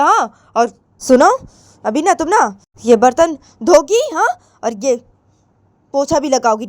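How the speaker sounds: high, loud scolding; threatening mother. Acted. Mother warning daughter and ordering chores, threatening.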